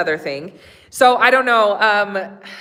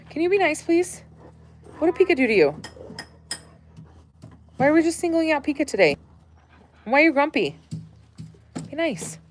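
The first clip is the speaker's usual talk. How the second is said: high pet scolding; 5 semitones higher, 4 dB quieter than usual. Real, not acted. Scolding dogs gently.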